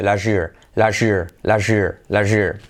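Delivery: "last year" as "lash-year", T dropped into SH; linked SH sound. Shown as a demonstration. Teacher drilling how T plus Y becomes SH, repeated demonstration. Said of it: "se convierte en una S H".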